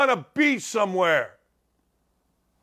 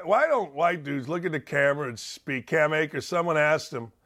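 normal talking voice

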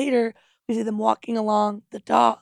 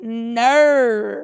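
put-on Australian accent; 5 dB louder than usual. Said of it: "Cam did the".

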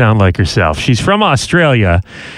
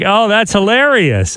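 higher-pitched mock amused reaction; imitando diversión; 7 semitones higher than usual. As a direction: voice her friends finding it funny at first. Friends' amused first reaction, retold.

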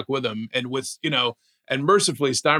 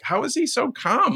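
much higher, disbelieving question; incredulous; 12 semitones higher than usual. Retold or imitated. Watching Jeter's calm draft reaction, high disbelief. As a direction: voice jumps up in amazement. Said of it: "I'm like".

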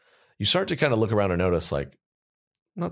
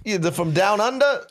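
Boston barber. Pretend. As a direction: bark it like a gruff Boston barber. Barber impatient with customer.

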